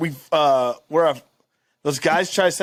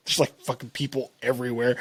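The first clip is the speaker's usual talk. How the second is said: whisper; 7 semitones lower, 7 dB quieter than usual. Retold or imitated. Sotto aside at party.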